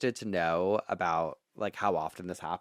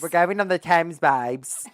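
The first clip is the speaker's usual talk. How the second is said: put-on loud, higher Essex accent with a drawn babes; 8 semitones higher, 7 dB louder than usual.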